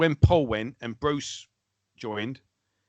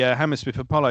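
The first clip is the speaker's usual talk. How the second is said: normal talking voice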